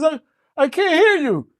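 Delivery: lightly voiced frog, high and odd; a frog who can't hear a word; deaf frog. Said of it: "He says".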